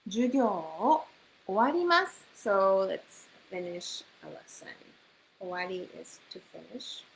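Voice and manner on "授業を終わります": 終わります said high and emphatic; slow model phrase. Shown as a demonstration. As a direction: split it up and lift 終わります high. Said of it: "it's,授業を終わります。".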